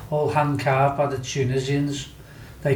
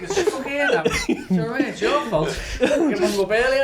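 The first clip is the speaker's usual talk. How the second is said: laughing retold reply; 10 semitones higher than usual. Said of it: "I said".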